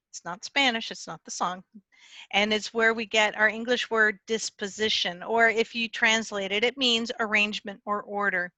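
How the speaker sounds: normal talking voice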